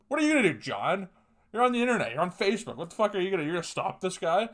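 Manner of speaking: high, loud sneering taunt; mocking a fifty-year-old tough guy. Imitating a fifty-year-old guy taunting John on Facebook, high and loud. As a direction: jeer at John like a cocky online bully. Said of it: "this fifty-year-old guy who just".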